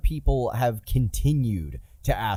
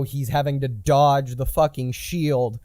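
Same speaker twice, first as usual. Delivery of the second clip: ordinary delivery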